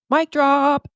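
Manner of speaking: high, loud victory call; triumphant. Real, not acted. Podcaster capping her own online reply, triumphant. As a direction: punch it out high as a closing flourish.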